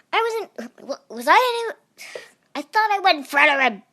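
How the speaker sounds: high, fast indignant rant; high rapid indignant protest; outraged protest. Real, not acted. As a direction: sputter it out in disbelief. Outraged protest after dying.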